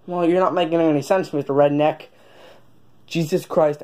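ordinary talk, nothing marked

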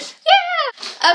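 high, loud cheer; excited yeah. Real, not acted. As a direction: cheer like you can't wait. Excited to start tasting.

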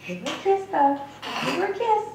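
very high, coaxing pet talk; high voice to a dog. Acted. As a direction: cheer the dog on, sky high.

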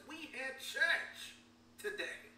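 loud slow "We had church" that fades off on "today"; exclaimed. Retold or imitated. Churchgoer exclaiming after a powerful service, loud. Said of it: "Christians yell out and they exclaim".

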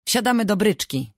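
put-on a child eagerly announcing the game; excited call. A narrator's reading. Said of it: "zawołała Rozalka".

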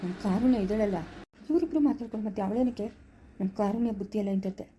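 low, soft grumble; muttered aside. Acted. Uncle grumbling about daughter Karunya, muttered aside. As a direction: mutter it privately to yourself.

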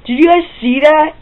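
sudden high-pitched surprise; real startled question to viewers. Real, not acted. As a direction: react as if something just jumped at you. Something flew up off his arm.

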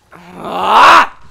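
short, very loud cry; startled yell. Real, not acted. Gamer stepping on a hidden trap, yelling loud. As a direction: let out a sudden shocked yell.

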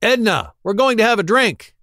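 calling out loud and high across the room; yelling. Acted. Bebe yells for Edna to join the drinking, loud and high. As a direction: shout for Edna to come have a drink.

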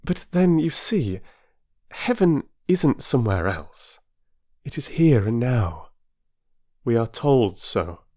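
a touch of kindly condescension, as if instructing; patiently setting someone right, lightly superior; mild explaining superiority. A narrator's reading. Mrs Arbuthnot gently correcting Mrs Wilkins, lightly condescending. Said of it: "slight, though sympathetic superiority of the explainer".

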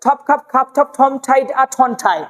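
ঠপ, খাপ, ঠাইড rattled off with puffed stops; aspirated T and K. Shown as a demonstration. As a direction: run ঠপ to ঠাই together, aspirating each ঠ and খ. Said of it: "তাড়াতাড়ি বলতে পারবেন না".

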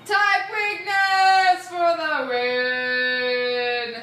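drawn-out victory cry; slow triumphant shout. Real, not acted. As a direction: stretch it out in triumph.

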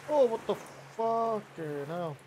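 slow, high disbelieving "what the hell"; drawn-out stunned swear; shocked outburst. Real, not acted. Boat suddenly wrecked, outburst.

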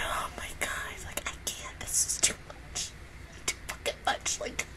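breathy, tearful whisper; overwhelmed whisper. Real, not acted. Fan overwhelmed by the scene, whispering through tears. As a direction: whisper it, undone by emotion.

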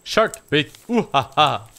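teasing chant with mock laugh; taunting sing-song. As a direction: jeer it like a playground taunt. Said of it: "That's enough out of you".

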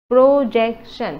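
Projection split slowly, first syllable loud. Shown as a demonstration. Teacher repeating for students, projection in three slow beats. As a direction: hit and separate the syllables of projection. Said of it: "এই তিনিটা syllable আছে".

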